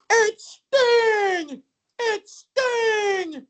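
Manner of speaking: very high, far-off mock squeal; drawn-out, hammed-up cry of fanboy joy; Tony's ecstatic announcing. Make-A-Wish kid getting his one wish, ecstatic squeal. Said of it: "And he wants to say".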